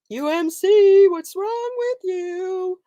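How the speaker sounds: high, loud, then slow exasperated inner yell; mock scream at your own blank mind. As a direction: scold yourself in your head. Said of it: "my mind is screaming at me".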